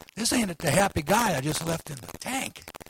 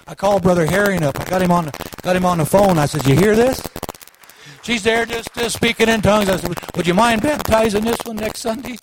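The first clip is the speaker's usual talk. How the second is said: loud, high, joyful testimony; excited preaching; 5 semitones higher, 10 dB louder than usual. Acted. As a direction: ride the excitement up to the punchline.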